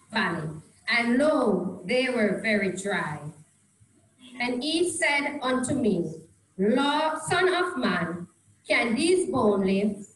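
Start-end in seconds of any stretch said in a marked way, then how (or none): none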